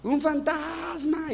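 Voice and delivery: high, drawn-out mock shriek; frightened scream. Disciples seeing Jesus on the water, high panicked scream. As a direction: scream it in comic terror, very high. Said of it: "¿qué es lo que gritan?".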